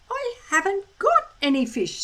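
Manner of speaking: a lightly voiced Hiccup answering; speaking Dragonese. A narrator's reading. Hiccup answers the dragon in Dragonese, lightly voiced. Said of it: "said Hiccup in Dragonese".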